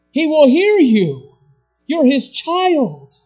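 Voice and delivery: loud, high and slowed promise; slow preaching assurance. Acted. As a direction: press each word home slowly.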